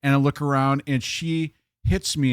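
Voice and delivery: ordinary delivery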